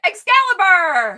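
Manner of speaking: dramatic shout. Pretend.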